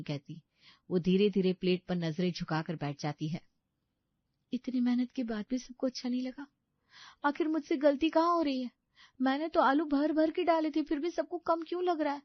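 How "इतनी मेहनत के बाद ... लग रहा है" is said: high, plaintive self-questioning; hurt daughter-in-law. Acted. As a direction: wonder in a high voice why you failed. Voices Sapna's hurt inner thoughts.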